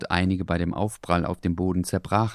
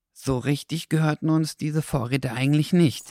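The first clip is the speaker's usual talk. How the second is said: higher, hesitant dog voice; 8 semitones higher than usual. A narrator's reading. Dackel confesses to the fox, hesitant. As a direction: admit it reluctantly. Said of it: "gab Dackel nun zögernd zu".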